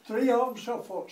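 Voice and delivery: loud, high stubborn retort; stubborn haggling. Acted. Sam refusing to budge on his price to Noah, stubborn.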